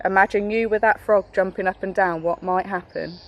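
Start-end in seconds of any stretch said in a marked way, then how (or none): none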